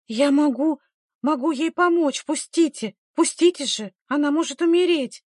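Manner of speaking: high, urgent broken plea; struggling, panting. A narrator's reading. Midwife fighting to get back to her patient, struggling. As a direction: plead urgently while fending someone off. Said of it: "пыхтя отбивалась повитуха".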